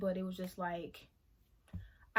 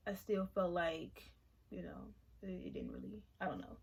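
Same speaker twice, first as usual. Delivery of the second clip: fading to near whisper; faltering quiet; 2 semitones lower, 7 dB quieter than usual. Real, not acted. Admitting it didn't help, a quiet fading trail-off. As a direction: let it trail off softly.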